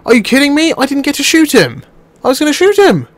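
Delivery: high, indignant complaining. Real, not acted. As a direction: protest at the cheated chance.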